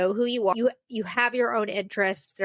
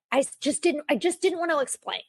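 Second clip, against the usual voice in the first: normal talking voice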